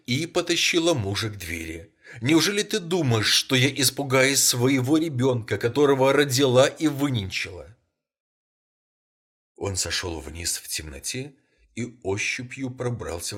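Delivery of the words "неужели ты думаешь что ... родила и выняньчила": higher and louder, fierce and defiant; fierce shout. A narrator's reading. Mother shouts that she fears no child of hers. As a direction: fling it at him, defiant. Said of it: "закричала старуха".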